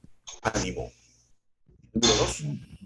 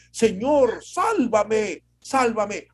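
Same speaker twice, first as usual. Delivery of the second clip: Peter's cry for help; 5 semitones higher, much slower than usual. Retold or imitated. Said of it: "comenzó a gritar".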